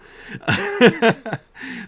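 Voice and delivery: high, loud burst of laughter; real laugh. Real, not acted. Streamer amused that the characters should kiss, laughing.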